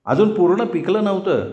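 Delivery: ordinary delivery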